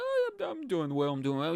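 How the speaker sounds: very high, forced-bright polite reply; fake cheerful British. Mocking Brits hiding sadness in public, forced and chirpy. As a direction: put on a chirpy public face. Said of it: "You have to be like".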